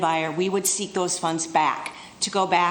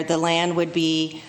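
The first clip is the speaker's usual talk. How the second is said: ordinary delivery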